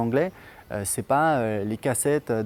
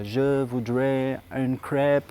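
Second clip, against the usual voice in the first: American friend's French; much slower than usual. Retold or imitated. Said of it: "elle a appris à dire".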